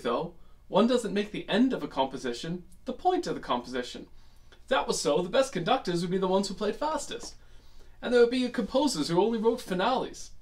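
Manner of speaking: put-on British lecturer's accent, lilting with measured pauses; put-on British accent, phrase by phrase; Alan Watts. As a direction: imitate a British philosopher, playfully musing. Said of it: "I just did a terrible accent".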